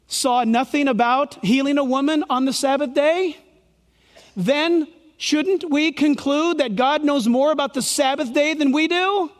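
usual speaking voice

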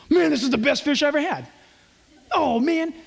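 playful Peter gushing over his meal; Peter savoring fish. Retold or imitated. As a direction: rave about the fish between bites. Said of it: "I could just see Peter".

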